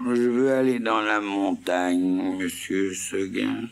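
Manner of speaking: lente, appuyée sur « veux »; réclamer en traînant; chèvre qui insiste. Acted. (la chèvre insiste, lente et appuyée)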